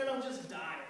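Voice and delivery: ordinary talk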